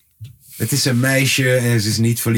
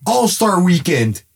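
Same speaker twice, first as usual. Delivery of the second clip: high-pitched playful exhortation; mock pleading; 7 semitones higher, slower than usual. Fan urging [name] to release an album, high mock plea. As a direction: plead up high, as if calling to him.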